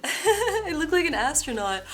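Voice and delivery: giggling through "I look like an astronaut"; laughing. Real, not acted. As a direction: laugh while saying it, delighted. Laughs trying on puffy jacket.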